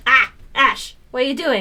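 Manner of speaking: loud, high call to the cat; excited calling. Real, not acted. Greeting the cat loudly.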